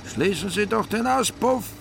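loud, high-pitched policeman's yell; bellowed order. A narrator's reading. Policeman ordering drivers to close the exhaust, bellowing. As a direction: bellow at the passing car. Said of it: "brüllte sie ein Schutzmann an".

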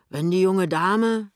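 loud, slow, emphatic wager; loud, drawn-out bluster; blustering shopkeeper husband. Acted. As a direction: announce it like a boastful tradesman. Voices the fat husband.

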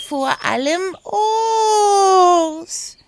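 sehr hoch und langsam gezogen, lauter; schwärmerisch gedehnt. Acted. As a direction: das O genüsslich auskosten. Oda, drawn-out longing. (hoch gedehntes Schwärmen)

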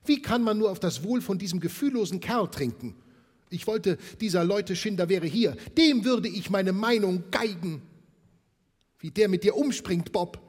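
fast, high, loud tirade against the boss; angry wife. Acted. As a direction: spit out her fury at Scrooge. Wife rages at Scrooge.

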